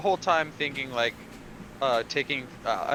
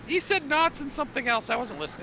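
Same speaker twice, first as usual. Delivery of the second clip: high, quick eager recruit voice; eager pirate recruit; 12 semitones higher than usual. Acted. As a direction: play a keen little would-be pirate, pitched high. Recruit chatters about task.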